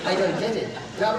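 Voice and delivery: ordinary talk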